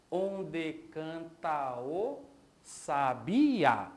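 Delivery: "Onde canta o sabiá" drawn out by syllables, final "sabiá" loud; escansão do verso. Shown as a demonstration. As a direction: scan "Onde canta o sabiá" slowly, hitting the last A. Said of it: "como é que fica aqui?". ("Onde canta o sabiá" devagar, sílaba por sílaba, "sabiá" mais forte)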